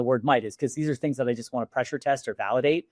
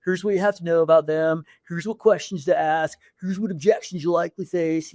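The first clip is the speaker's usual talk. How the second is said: laid-back surfer dude; lightly voiced surfer guy; 3 semitones higher than usual. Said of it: "the surfer voice".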